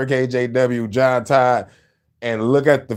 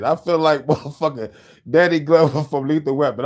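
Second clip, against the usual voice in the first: normal talking voice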